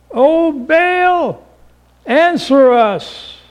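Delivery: high, slow pleading cry to an idol; prophets crying to Baal. Acted. Prophets of Baal pleading high and slow to their god. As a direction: wail it upward to a silent god. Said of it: "they called on the name of Baal".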